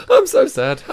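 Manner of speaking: high squeaky mock whimper through laughs; laughing fake sadness. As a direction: whine it through giggles. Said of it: "saying things and laughing at the same time".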